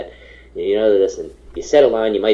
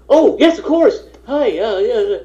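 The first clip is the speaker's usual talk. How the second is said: very high, louder, flustered yeses; flustered fawning; 4 dB louder than usual. Retold or imitated. Extra realizing he faces Robert Altman, flustered very high fawning. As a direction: gush nervously at the famous director.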